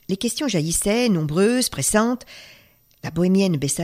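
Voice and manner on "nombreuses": usual speaking voice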